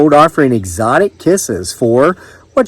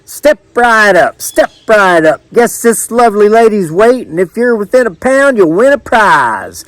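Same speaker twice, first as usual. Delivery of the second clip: high, stretched carnival barker call; caller's yell; 8 semitones higher than usual. A narrator's reading. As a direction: call the crowd in, drawing out the words. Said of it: "the caller yelled".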